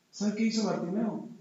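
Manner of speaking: ordinary talk, nothing marked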